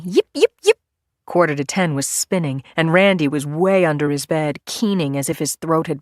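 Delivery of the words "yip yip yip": high mousy yips; short, high, squeaky yips; squeaking. A narrator's reading. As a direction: squeak like a little mouse. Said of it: "Fred Mouse was squeaking".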